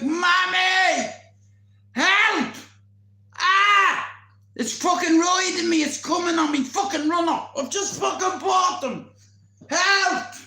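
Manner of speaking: re-enacted high, loud cries for help; panicked screaming. Trapped with a dog for fifteen minutes, panicked screaming. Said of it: "I was like".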